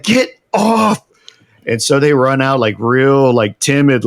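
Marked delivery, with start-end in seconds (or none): 0.0-1.0 high, strained mock yell; retold yell. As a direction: re-enact an insistent order, pitched way up. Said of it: "and I yell".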